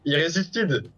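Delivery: excited. Pretend. Hammed-up French raid call.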